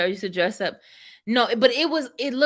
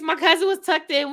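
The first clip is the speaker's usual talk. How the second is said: normal talking voice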